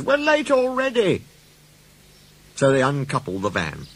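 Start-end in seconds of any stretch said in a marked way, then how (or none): 0.0-1.2 high-pitched impatient complaint; grumbling. Acted. Passengers complain of delay, cross grumble. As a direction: protest with pitch shooting up. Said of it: "grumbled the passengers".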